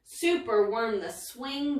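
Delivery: usual speaking voice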